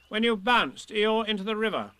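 lightly voiced fussy rabbit. Rabbit accuses.

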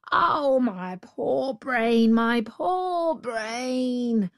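slow, drawn-out mock wail; jackal's mock lament. The jackal fakes confusion to the tiger, slow mock wail. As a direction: ham up the despair, very slowly. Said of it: "cried the jackal".

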